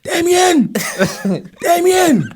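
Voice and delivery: high loud call up the stairs; mum calling. Retold or imitated. Tired mum calls her son down, loud high shout. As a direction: mum hollering her son's name from downstairs. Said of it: "And then she just go".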